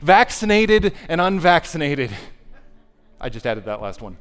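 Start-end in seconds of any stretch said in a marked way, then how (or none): 0.0-2.1 loud, ringing pulpit declaration; mock-grand proclamation; preaching at full force. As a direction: proclaim it, building louder. Preacher voicing Jesus, rising loud.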